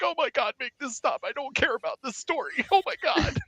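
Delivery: high-pitched exasperated pleading; high, softened desperate complaint; fed-up bartender. Acted. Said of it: "one of his bartenders is like".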